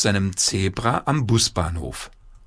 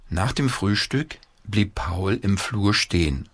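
ordinary delivery

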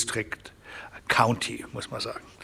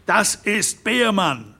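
shout; 7 semitones higher, much slower than usual. A narrator's reading. Harran Derrick naming the culprit to the room, a slow raised cry. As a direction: call it out slow with alarm. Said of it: "schrie Harron Derrick".

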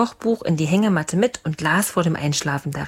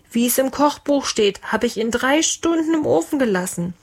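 high, put-on small voice; timid little cook; 6 semitones higher than usual. Small cook justifies the burnt roast, high and timid. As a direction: plead innocence in a raised pitch. Eingeschüchterter Kurzer rechtfertigt sich.

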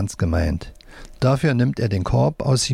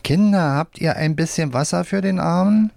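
lightly voiced puppet, pitched higher; right-hand puppet; 7 semitones higher than usual. A narrator's reading. Right hand begs the children for water, lightly voiced puppet. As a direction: call to the children in a raised puppet voice. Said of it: "Wolfram mit der rechten Hand".

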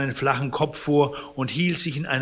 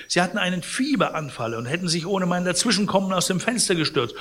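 brisk explanation; 7 semitones higher, 4 dB louder, faster than usual. Acted. Concierge explains away.

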